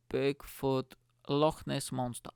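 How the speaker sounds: ordinary delivery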